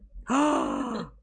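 high sharp gasp of delight; a big gasp; surprised gasp. Real, not acted. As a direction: gasp as if amazed. S0 reacts to S1 speaking Spanish.

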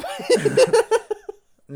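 slow, loud laughing explanation. Real, not acted. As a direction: explain slowly through laughter. Mock lecturing, laughing.

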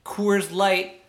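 ordinary talk

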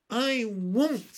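put-on high, loud little-girl refusal; stubborn, high-pitched protest; defiant refusal. A narrator's reading. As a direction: dig your heels in. Child refuses sister's order.

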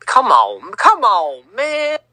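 normal talking voice

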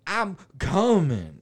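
high, halting, drawn-out answer; mock dopey son's holler; slow-witted son. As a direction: stammer back to mom, stretching the last word. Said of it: "the one guy's like".

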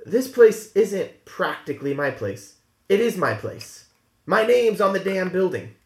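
rising, pointed indignation; indignant older sister. Acted. Sally asserting ownership to the crew, indignant. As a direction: correct them sharply. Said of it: "she turns and goes".

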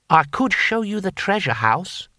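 volunteer it brightly as a helpful official; mildly ingratiating helpfulness; helpfully. A narrator's reading. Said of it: "said Six Beneficent Winds helpfully".

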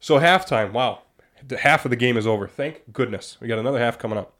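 normal talking voice

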